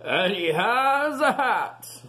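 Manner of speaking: high, slow pirate voice; hammy pirate mate; gruff first mate. Acted. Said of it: "called First Mate Scratch Whiskers".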